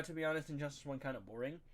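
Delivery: ordinary talk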